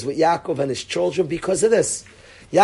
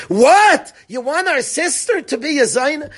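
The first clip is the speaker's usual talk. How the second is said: screamed verse; 7 semitones higher than usual. Acted. Brothers answer their father over their sister, screaming. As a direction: cry it out, pitch leaping up in protest. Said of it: "I'm screaming the pasuk".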